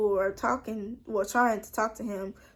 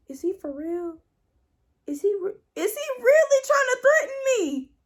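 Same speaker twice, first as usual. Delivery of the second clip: climbing high and loud, laughing through it; can't believe he's threatening her; incredulous; 10 semitones higher, 4 dB louder than usual. Retold or imitated. To Jerome after the punch threat, incredulous laughing. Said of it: "that's literally what I was thinking".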